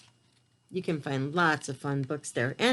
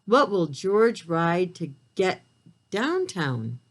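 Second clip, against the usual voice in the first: ordinary delivery, nothing marked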